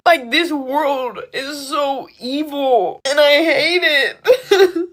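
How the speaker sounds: high, drawn-out cry of grief; tearful, slow outburst; anguished anger. Real, not acted. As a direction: stretch each word slowly as the voice rises toward tears.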